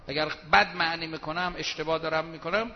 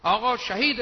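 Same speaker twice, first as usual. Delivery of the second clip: higher, louder cry of martyr; higher, louder mock claim; mock exclaim; 5 semitones higher, 7 dB louder than usual. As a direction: proclaim it indignantly. Acts claimant voice.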